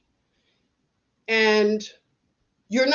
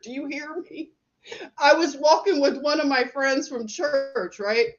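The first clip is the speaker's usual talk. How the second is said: ordinary delivery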